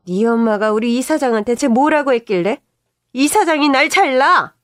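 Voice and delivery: loud, high, outraged shouting; furious yell. Retold or imitated. Mother-in-law yelling about being fired, furious shouting. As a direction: yell the accusation.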